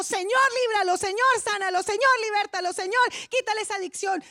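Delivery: impassioned intercessory cry; fervent plea. Acted. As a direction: cry out to the Lord for someone. Voicing the Spirit's intercession.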